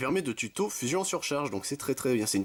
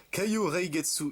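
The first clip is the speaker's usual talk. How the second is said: put-on Japanese accent, slightly higher pitch; 7 semitones higher, slower than usual. Shown as a demonstration. Vlogger warning viewers about his Japanese accent, Japanese accent. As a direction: read the card name in your Japanese accent. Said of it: "attention à mon accent japonais".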